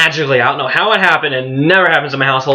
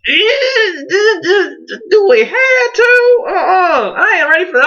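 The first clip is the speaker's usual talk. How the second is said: whiny crying plea; 3 dB louder than usual. Retold or imitated. Son begging mom not to tell dad, whimpering high. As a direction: beg like a scared kid who isn't ready. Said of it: "And I was just like".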